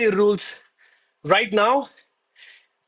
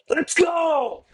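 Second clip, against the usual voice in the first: hype shout; 10 semitones higher than usual. Real, not acted. Streamer hypes his chat after a set of push-ups, pumped-up shout. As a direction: roar it out like you just won.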